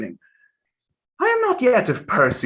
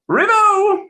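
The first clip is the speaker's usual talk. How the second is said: put-on high drunken cry; hiccuping toast from a drunk; town drunk; 5 dB louder than usual. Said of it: "says the drunkard".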